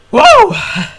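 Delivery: sudden high shout of surprise; loud startled whoa. Real, not acted. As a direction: yelp out whoa. Sudden exclamation mid-sentence.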